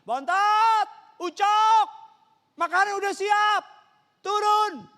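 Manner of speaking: very high, very loud calling out; mother yelling. Retold or imitated. Mother calling her children down to eat, piercing yell. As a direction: holler like a mother calling upstairs. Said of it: "mama saya teriak".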